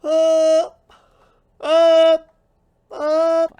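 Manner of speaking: loud, very high repeated yelp; short squealed bursts, evenly spaced. Acted. Wordless high noises while playing.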